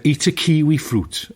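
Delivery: put-on Welsh accent. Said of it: "She said".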